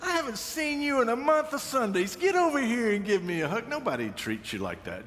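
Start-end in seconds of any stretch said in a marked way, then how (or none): 0.0-3.7 high, delighted grandma-style gush; hammed-up coworker greeting like a grandmother; high gushing welcome with a laugh; gushing greeter. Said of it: "people said".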